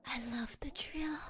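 breathy, sly confession; secret whisper. Acted. Girl whispering her guilty pleasure to crush.

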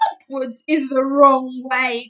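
loud, slow indignant giant; indignant booming giant; giant crying out. Acted. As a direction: insist loudly, offended. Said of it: "cried the B F G".

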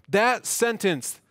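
ordinary talk, nothing marked

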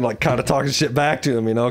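ordinary delivery

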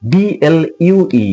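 normal talking voice

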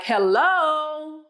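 high, drawn-out "hello"; sharp-sounding hello. Retold or imitated. Wife answering the babysitter's call on her watch, sharp drawn-out hello. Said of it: "I wouldn't dare say anything like that".

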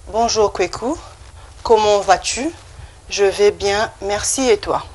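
"Comment vas-tu" lifted at the end, phrase by phrase; pronunciation model. Shown as a demonstration. Teacher reading a greeting dialogue for repetition, pronunciation model. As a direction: model "Je vais bien" in careful French, pausing for repeats. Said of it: "You can just repeat after me".

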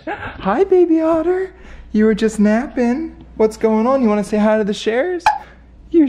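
high, sing-song voice for a sleepy puppy. Real, not acted. Greeting the sleepy dog.

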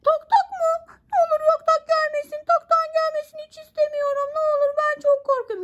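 high, pleading little toy voice; scared puppet voice. Acted. Toy Miki begging mom not to be smacked, high mock-scared voice.

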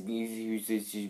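usual speaking voice

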